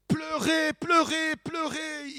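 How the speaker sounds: high-pitched, repeated with a rising lilt; mock insistence. Preacher listing what they demand to see, mock insistence. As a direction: hammer the word in a high, mocking sing-song. Mocking demand for emotional phenomena.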